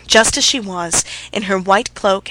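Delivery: ordinary delivery, nothing marked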